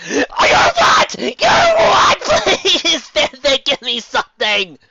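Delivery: screaming high and loud, crying out; screaming high-pitched outburst; shrieking. Acted. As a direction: shriek it in outrage.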